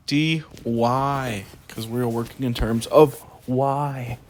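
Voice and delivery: slow and loud, overdo the importance; playfully dramatic. Real, not acted. Teacher finishing an integral for video viewers, playfully dramatic. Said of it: "Sorry to be so dramatic".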